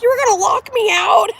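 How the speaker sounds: high, loud indignant question; mad partner. Retold or imitated. Retelling partner's anger at nearly being locked out, high mad yell. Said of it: "he got all mad at me".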